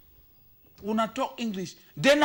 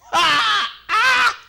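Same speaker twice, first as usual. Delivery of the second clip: surprised shout; 11 semitones higher, 6 dB louder than usual. Real, not acted. Reacting to the house rules.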